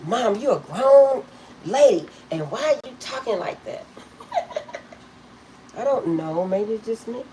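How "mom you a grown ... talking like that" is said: mock daughter scolding. Daughter mocking her mother's romantic talk, loud and teasing. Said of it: "She says".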